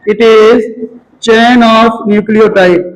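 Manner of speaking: slow, word-by-word dictating pace; dictating to students; slow dictation. Shown as a demonstration. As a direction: read it out slowly for notes. Repeats dictation for the class.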